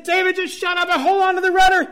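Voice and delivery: high, loud, frantic yelling; acting out a frantic boat argument; exasperated retold shout. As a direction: shout the order over mock panic. Retold shouted order in sinking boat.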